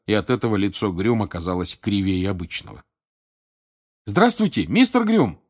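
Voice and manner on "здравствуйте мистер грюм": высокий, громкий окрик через толпу; окликнуть учителя издалека. Acted. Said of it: "крикнул Гарри". (крикнуть звонко, высоко)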